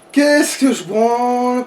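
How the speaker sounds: ordinary talk, nothing marked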